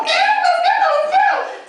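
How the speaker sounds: eager. Retold or imitated. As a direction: jump at the free meal. Eager for a free meal.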